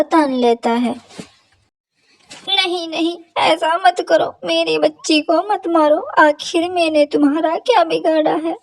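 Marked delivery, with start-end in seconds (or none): 2.5-8.5 put-on high, desperate plea for her child; pleading mother crow. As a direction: beg the hawk to spare your daughter. (ऊँची आवाज़ में गिड़गिड़ाती माँ)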